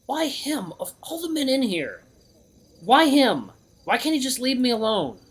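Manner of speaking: high, whining complaint; exasperated lament. Acted. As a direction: plead with the heavens in a high voice. Hero laments who found him.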